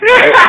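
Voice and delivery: very high, loud squealing right. Real, not acted. Teller shrieking right at the crowd. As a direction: shriek it out, still dolphin-high. Shrieking after dolphin bit.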